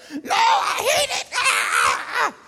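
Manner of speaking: very high, loud mock wail; screechy tantrum ending in a yell; toddler freaking out. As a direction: imitate a screaming toddler meltdown. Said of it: "he just starts freaking out".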